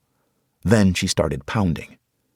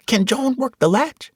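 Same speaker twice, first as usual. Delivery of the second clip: put-on very high boy's voice; young boy voice. Malik agreeing with Tasha, high boy's voice. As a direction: voice a small boy.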